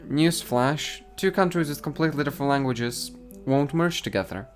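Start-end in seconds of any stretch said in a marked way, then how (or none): none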